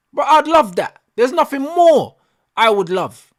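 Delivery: heartfelt, loud protest; passionate outburst. Real, not acted. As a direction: insist on it loudly, pitch jumping up. Passionate plea for better football.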